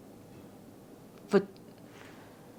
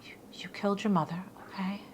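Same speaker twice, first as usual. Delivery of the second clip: hushed, hurried whisper; suppressed shock, retold; 10 dB quieter, faster than usual. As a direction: whisper it, trying to keep a straight face. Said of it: "I'm trying to stop".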